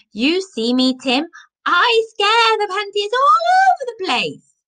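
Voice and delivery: high, loud gleeful boast; triumphant Viking. A narrator's reading. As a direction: crow it across the garden like a victorious warrior. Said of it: "burst out laughing".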